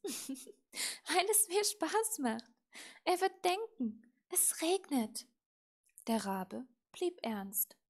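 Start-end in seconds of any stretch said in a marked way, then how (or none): 0.0-5.3 high, louder, relishing the prank; mischievous glee. A narrator's reading. Little witch to raven about her prank, mischievous. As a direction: impish and pleased with the trick.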